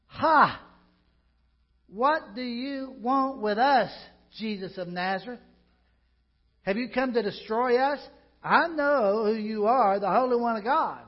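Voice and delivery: loud, high demon's cry. A narrator's reading. Pastor reading the demon's cry to Jesus in the synagogue, loud and high. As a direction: shout it like a possessed man. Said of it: "cried out at the top of his voice".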